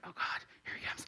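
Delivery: whispered mock dread; panicked whisper. Woman sees the officer coming, panicked whisper. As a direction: whisper it under your breath in alarm.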